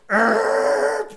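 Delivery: high, loud brake squeal; screeching tires done by mouth; imitated braking screech. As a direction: mimic a truck screeching to a stop. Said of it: "pulls in".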